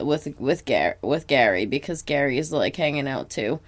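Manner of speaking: ordinary delivery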